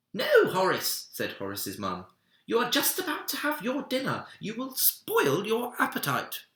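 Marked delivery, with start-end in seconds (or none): 0.1-1.1 much higher and louder stern mum "No, Horace"; a mother's raised warning, pitched way up; shrill-high mum. Acted. Mum forbids again, higher. 2.5-6.5 much higher, faster, louder mother's scolding; a fussing mum voice pitched way up. Acted. Mum scolds before dinner.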